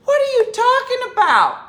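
high, loud, outraged what are you talking about; loud incredulous outburst; loud disbelief. Real, not acted. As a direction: shout it in full disbelief. Outraged aside to cohosts.

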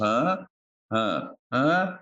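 HUH? with a sharply rising questioning tune; mimicked baffled huh. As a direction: imitate a puzzled listener, lifting each "Huh?". Imitates people's baffled response.

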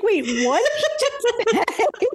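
high, slow, laughing disbelief; lightly acted baffled laugh; confused disbelief. Retelling her own confused reaction.

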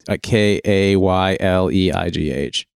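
slow letter-by-letter spelling of K A Y L E I G H. Shown as a demonstration. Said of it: "Her first name is spelled".

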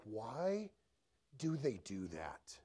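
very low, quiet, slow-burning question; private exasperation. Retold or imitated. Newlywed silently questioning a spouse's habit, low exasperation. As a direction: drop it low, as if stewing to yourself.

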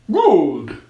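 loud snowman voice. A narrator's reading. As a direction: approve as a snowman. Snowman approves.

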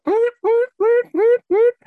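very high, loud mock car alarm whoops; car alarm imitation. Mimicking the car alarms on the ferry deck with high loud whoops. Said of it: "this car alarm would go".

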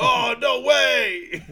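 high, very loud, stretched-out mock cry; hammed-up yell. Retelling a hit to the nuts, hammed-up yell. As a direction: yell it slowly through amusement. Acted reaction to blow.

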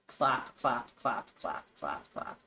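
low claps dropping away to nothing; spooky claps fading out. Acted.

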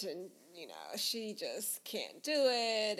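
ordinary talk, nothing marked

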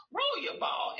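high, sing-song roll; expanding. Shown as a demonstration. Therapist modeling an expanded phrase for a toddler. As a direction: lift it up playfully. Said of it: "when you do expansion".

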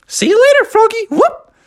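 very high squeaky cartoon mouse; Mickey. Mickey diving into the toy bin, high squeaky voice. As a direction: squeak a quick cartoon goodbye.

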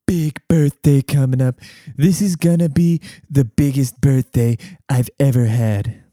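lightly voiced blustery tycoon, slow on "big". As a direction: puff it up like a bragging showman. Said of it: "my [name] and [name] just kinda morphed into one".

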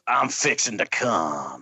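slow, low gruff growl; gruff snarling wolverine. Pretend. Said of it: "say that in your Wolverine voice".

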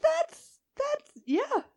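high-pitched giggly agreement; half-laughing, mock revelation. Retold own reaction, laughing.

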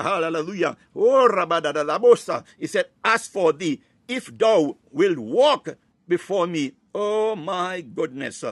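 ordinary talk

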